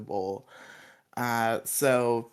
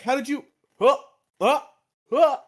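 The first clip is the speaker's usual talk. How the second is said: panicked stammer; 13 semitones higher, 8 dB louder than usual. Retold or imitated. Panicked putty realization.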